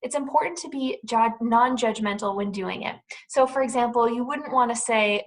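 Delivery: ordinary delivery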